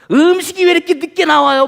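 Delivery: loud, high mock outburst at the waiter; pretend angry complaint. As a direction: snap like an impatient customer. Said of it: "막 화를 내거나".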